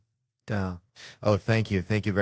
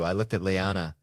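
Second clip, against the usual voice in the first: ordinary talk, nothing marked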